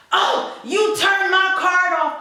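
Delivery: sing-song sneer at the teller; rude customer. Retold or imitated. Angry customer complaining his card was turned off, mock indignant.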